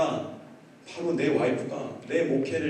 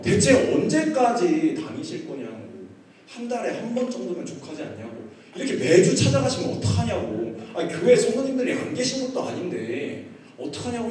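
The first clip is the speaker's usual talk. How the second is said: raised, insistent mock nagging; wife's complaint; 4 semitones higher, 4 dB louder than usual. Wife complains about husband's absences, nagging. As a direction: imitate a fed-up spouse complaining. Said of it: "갑자기 이렇게 얘기하는 거예요".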